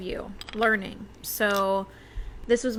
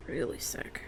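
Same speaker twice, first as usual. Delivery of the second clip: whispering; 8 semitones lower, 9 dB quieter than usual. Real, not acted. Medium senses spirit.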